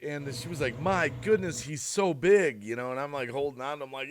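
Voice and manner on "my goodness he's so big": higher, louder, drawn-out wonder; amazed exclamation. Retold or imitated. Lulu sees the big dog, amazed. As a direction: stretch it out in astonishment.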